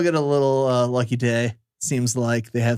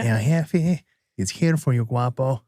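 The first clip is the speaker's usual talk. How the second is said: Jefe voice; slower than usual. Retold or imitated. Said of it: "'cause that's how he talks".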